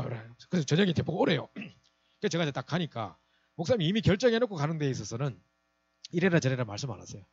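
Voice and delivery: ordinary delivery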